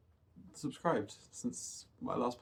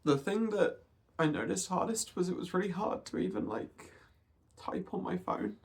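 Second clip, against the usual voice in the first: holding back tears, voice pitched higher; talking through tears, fighting to stay steady; tearful; 4 semitones higher than usual. Real, not acted. Vlogger telling viewers about a hard dysphoria day, tearful. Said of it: "I'm gonna try not to cry too much in this video".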